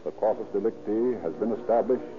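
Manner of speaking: ordinary talk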